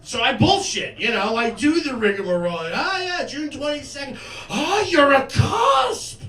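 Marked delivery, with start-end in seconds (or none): 2.7-6.2 high, breathless mock astrology fan; mock horoscope girl. Comic voicing the astrology girl he hits on. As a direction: play the star-sign girl gushing.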